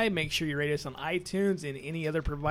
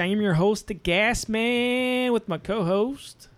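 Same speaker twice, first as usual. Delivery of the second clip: announcer voice; 4 semitones higher, 6 dB louder, slower than usual. Pretend. Podcast host intro, drawn out.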